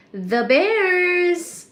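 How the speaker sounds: high, loud, drawn-out name call; excited sing-out of a name; delighted greeting. Real, not acted. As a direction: call out to a friend who just arrived. Calling out to arriving viewer.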